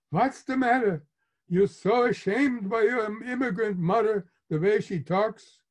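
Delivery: aggrieved Yiddish mama act; put-on Yiddish accent. A narrator's reading. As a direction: chide your son in a hammed-up Yiddish accent. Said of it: "I can hear the put on".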